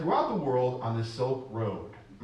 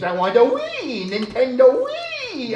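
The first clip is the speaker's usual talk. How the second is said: high, loud, slow yearning for a Wii; high eager puppet; excited wanting; 11 semitones higher, 9 dB louder, slower than usual. Acted. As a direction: drag out the wish greedily.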